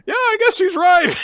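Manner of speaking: a meek squeaky agreement; squeaky hero. Retold or imitated. Gakuro voiced in squeaky tone.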